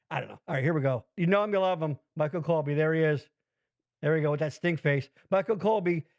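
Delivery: normal talking voice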